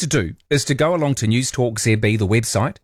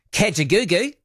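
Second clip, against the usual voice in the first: put-on Australian accent; 7 semitones higher than usual. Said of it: "said that with an Australian accent".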